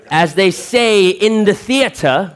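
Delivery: high, slow and grand like a stage actor; slow, lofty stage declamation; theatrical voice. Shown as a demonstration. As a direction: announce it like a hammy thespian. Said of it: "'cause I'm an actor".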